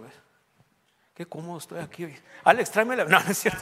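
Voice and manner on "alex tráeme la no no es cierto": louder, higher mock order to the helper; joking call out. Real, not acted. Preacher teasing Alex with a loud mock command. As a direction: bark it at Alex, then take it back.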